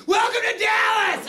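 sudden high shout; yelled. Pretend. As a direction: yell it out in a very high voice. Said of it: "I yell".

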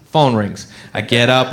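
usual speaking voice

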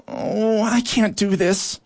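high, slow, anxious outburst; blurted out. A narrator's reading. Worker ant blurting out her dread on the march, high and anxious. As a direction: burst out unable to go on. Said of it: "Eartha eventually blurted out".

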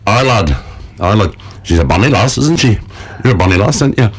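a lightly voiced puppet monkey in a northern English accent, flirting. Puppet monkey charming the host, northern English accent. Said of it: "northern accent".

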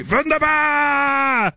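very high, long-held WUNDERBAR; exuberant cry. Acted. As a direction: belt it out and hold the final syllable. Repeats the chocolate bar's cry.

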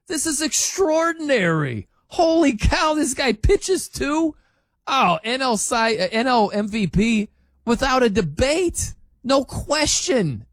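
mock-dazzled fan raving; gushing fan. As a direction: play the amazed fan losing his mind. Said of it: "They'd be like".

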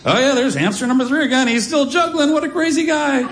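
high-pitched, mock-cheerful storybook narration; narrating to a toddler. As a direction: play up the hamster's antics for a little kid. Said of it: "so I just have to narrate".

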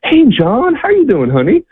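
imitating a woman suddenly cheerful, greeting warmly in a high voice; bright happy greeting. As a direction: greet an old friend with sudden delight. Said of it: "and she goes".

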